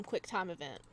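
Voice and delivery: ordinary talk, nothing marked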